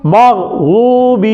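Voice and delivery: مغضوب drawn out very slowly, ض pressed with the tongue edge; slow demo. Shown as a demonstration. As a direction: draw مغضوب out long. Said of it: "مغضوب ہے اوپر".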